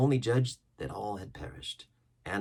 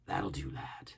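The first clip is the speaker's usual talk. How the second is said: whisper; 6 semitones lower, 7 dB quieter than usual. A narrator's reading. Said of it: "unvoiced whisper".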